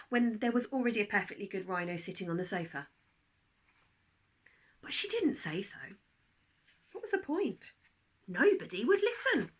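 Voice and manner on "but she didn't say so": usual speaking voice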